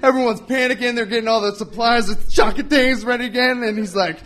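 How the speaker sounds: ordinary talk, nothing marked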